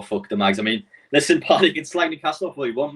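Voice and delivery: ordinary talk, nothing marked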